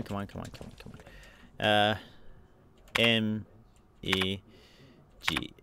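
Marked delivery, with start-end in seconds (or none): 0.0-1.7 hushed come on; whispered urging. Real, not acted. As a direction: plead in a whisper.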